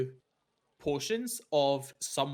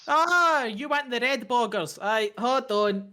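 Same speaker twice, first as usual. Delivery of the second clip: high chirpy Irish lilt; bright young Irish shopkeeper; Irish twang; 8 semitones higher, 5 dB louder, slower than usual. Acted.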